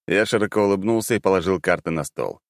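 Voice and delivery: usual speaking voice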